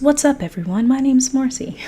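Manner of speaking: playful high-pitched self-parody; old-video intro. Retold or imitated. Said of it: "That sounds like my old videos".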